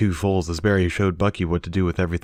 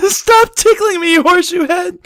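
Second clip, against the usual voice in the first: laughing protest; 9 dB louder than usual. Acted. Tickled pony squeals at Bucky through laughter, very high and loud. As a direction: protest while giggling uncontrollably. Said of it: "Barry cried".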